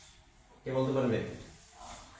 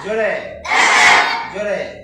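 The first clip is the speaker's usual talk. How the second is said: loud, higher call to the class; teacher shouting for more volume; louder!; 5 semitones higher, 13 dB louder, slower than usual. Real, not acted. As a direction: shout the cue to push them. Teacher urges class to chant louder.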